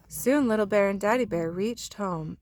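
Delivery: plain reading voice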